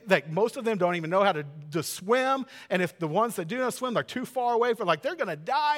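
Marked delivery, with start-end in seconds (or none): none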